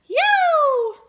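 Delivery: huge high shouted yo; yelled. Acted. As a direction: blast the yo out at the top of the voice. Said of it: "and yelled".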